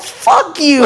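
high, drawn-out cursing; hammed-up retold anger; mock mad driver. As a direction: imitate an irate driver swearing out the window. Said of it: "he was all mad".

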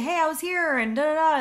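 bright door-knock opener; upbeat example greeting. Shown as a demonstration. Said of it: "Maybe you say".

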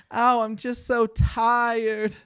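high, very slow put-on lady voice; old-timey women. Playing a lady complaining she's tired, high drawn-out voice. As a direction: draw it out high, hammed-up weary. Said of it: "No, that wasn't good".

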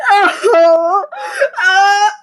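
loud laughing cry; loud laughing sob-groan. Real, not acted.

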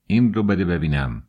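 plain reading voice

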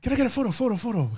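high-pitched, hammed-up Singaporean accent; mock Singaporean accent. Imitating a fan asking singer for photo, mock Singaporean accent. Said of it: "I'm doing this guy's accent".

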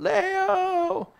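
high, drawn-out call of a name; a voice calling out to hurry him away; calling from afar. Retold or imitated. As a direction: call him back from across the street. Someone calling his name away.

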